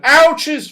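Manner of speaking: loud, high yelps; ouch cries. A narrator's reading. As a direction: squeal as snowballs land. Bears hit by snowballs.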